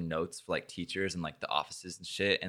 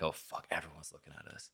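much softer muttered aside; embarrassed inner thought; 4 semitones lower, 14 dB quieter than usual. Retold or imitated. Thinking to himself in the crowded hall, muttered softly. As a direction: mutter it to yourself, mortified.

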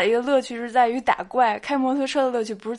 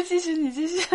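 usual speaking voice

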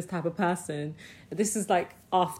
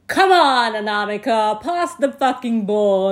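put-on boy yelling for the ball; retold shout; 2 semitones higher, 7 dB louder than usual. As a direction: yell it at her from the pitch. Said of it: "he was like".

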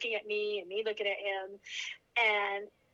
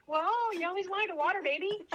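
higher, laughing through husband's joke; laughing retell; 8 semitones higher than usual. Retold or imitated. Mother retells husband's quip through higher laughter. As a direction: joke it while still giggling.

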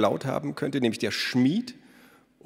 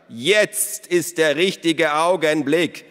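loud, high, slow signal call; 7 semitones higher, 7 dB louder, slower than usual. A narrator's reading. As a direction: shout the signal to the children. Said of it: "rief der Spurenfinder". (der Spurenfinder ruft laut und langsam das Signal)

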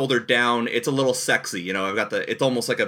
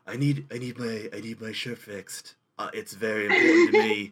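Johnny Depp; 2 semitones lower than usual. Acted. Improvising a film star fussing over his shirt, douchey celebrity voice. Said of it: "the douchey celebrity impression where it's".

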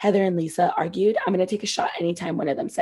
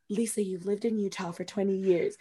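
quiet aside; 8 dB quieter than usual. Retold or imitated. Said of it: "She said this really quietly".

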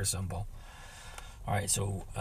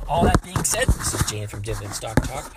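loud sign-off; 9 dB louder than usual. Real, not acted. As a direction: belt out the channel name.

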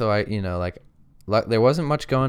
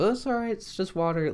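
lightly voiced brother, pitched much higher; easygoing brother; 12 semitones higher than usual. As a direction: play the calm brother up high, shrugging it off. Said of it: "and the brother goes".

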